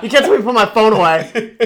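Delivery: high, loud and laughing; bratty yell, laughing. Retold or imitated. Kid confronting a shopping cop, laughing bratty yell. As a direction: yell it while cracking up.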